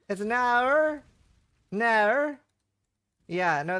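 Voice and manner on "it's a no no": "hour" and "No" as a higher-pitched Australian "naur". Shown as a demonstration. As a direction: stretch "No" into an Aussie "naur", pitched up. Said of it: "They have a very unique no".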